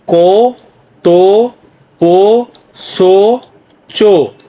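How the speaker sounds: long rising syllables, one by one; drawn-out syllable drill. Shown as a demonstration. Teacher reads row two to students, drawn-out syllables. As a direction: hold each vowel, pausing between them.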